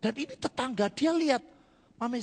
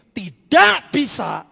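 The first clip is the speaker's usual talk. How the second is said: loud, slow shout; doctor's verdict; 4 semitones higher, 16 dB louder, much slower than usual. Retold or imitated. Doctor tells the patient there's no hope, shouting slowly.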